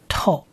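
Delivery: 透 as one joined t plus long o syllable; blended syllable. Shown as a demonstration. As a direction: say 透 softly on its own, t run into a long o. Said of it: "跟前面的特合在一起就变透". (示范拼读：t接长音o，读成透)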